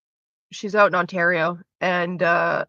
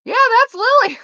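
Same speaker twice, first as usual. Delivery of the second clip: much higher, louder, half-laughing; amused realization; 8 dB louder than usual. Real, not acted. Telling chat about Lily, amused squeal. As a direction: squeal it as it all clicks. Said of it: "realizing Lily is Cartman".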